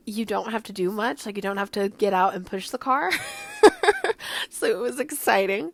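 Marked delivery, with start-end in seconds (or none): 3.1-4.4 high amused giggle. Real, not acted. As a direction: giggle at the joke. Laugh at pushing the car.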